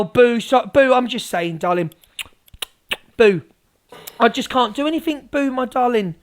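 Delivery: ordinary talk, nothing marked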